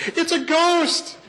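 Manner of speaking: high, slow, frightened shriek; hammed-up terrified yelp; terrified cry. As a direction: shriek it like grown men spooked by a ghost. Disciples terrified, think it's ghost.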